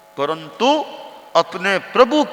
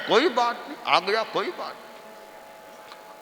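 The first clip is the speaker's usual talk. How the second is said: soft breathy imitation of calm; mock calm; 3 semitones higher, 7 dB quieter than usual. Disciple softly mimics the guru's unbothered reply. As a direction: murmur it as if nothing matters.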